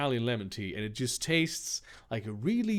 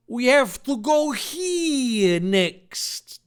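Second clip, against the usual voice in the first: slow, high, loud hero voice; exclaiming; 11 semitones higher, 7 dB louder, much slower than usual. A narrator's reading. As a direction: call it out eagerly, drawn out. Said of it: "Dave exclaimed".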